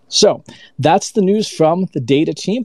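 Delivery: ordinary talk, nothing marked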